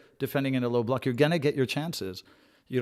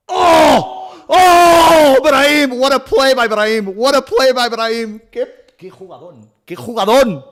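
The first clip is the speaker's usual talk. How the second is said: full-throated yell, much higher and louder; ecstatic shout; 13 semitones higher, 13 dB louder, slower than usual. Real, not acted. Cheering Brahim's play to the viewers, ecstatic shout. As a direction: scream with joy at the screen.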